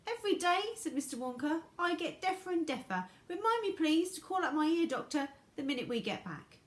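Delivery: high, loud, eccentric showman; high eccentric Wonka. A narrator's reading.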